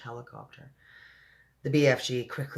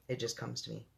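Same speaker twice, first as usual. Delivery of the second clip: usual speaking voice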